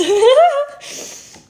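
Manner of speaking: big delighted cackle, high and loud; loud high laugh. Real, not acted. Vlogger laughing in delight, loud and high. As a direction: burst out laughing.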